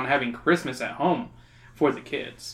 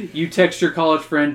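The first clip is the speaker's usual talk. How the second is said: ordinary talk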